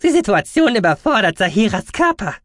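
high, loud doctor voice; alarmed medic. Acted. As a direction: warn them insistently. Doctor warns about the patient.

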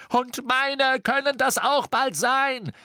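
high, drawn-out yell; heated shout at full pitch; angry scream. A narrator's reading. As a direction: scream it back at your friend. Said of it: "schrie Ron".

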